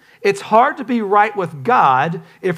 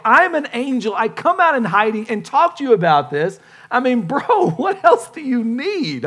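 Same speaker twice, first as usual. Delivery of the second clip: exasperated Gabriel; 3 semitones higher, 4 dB louder than usual. Acted. Angel Gabriel pointing Zechariah to his sign, mounting comic exasperation. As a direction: pile up the evidence, then burst out baffled. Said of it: "Gabriel's going like".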